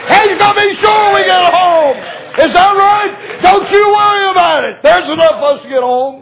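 high, shouted revival preaching; preaching at full force. Acted. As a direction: cry it out to the congregation.

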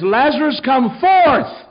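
very high, loud summons; commanding shout. Acted. Jesus looks in and calls the dead man out, commanding shout. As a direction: bellow it into the tomb. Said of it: "Jesus looks in there and says".

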